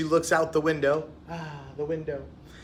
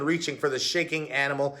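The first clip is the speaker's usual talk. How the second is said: plain reading voice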